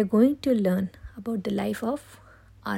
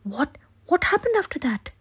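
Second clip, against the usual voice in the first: put-on eager child voice; 5 semitones higher, 3 dB louder than usual. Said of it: "Julian and Nathan interrupted her".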